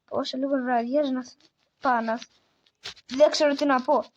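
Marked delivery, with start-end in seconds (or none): none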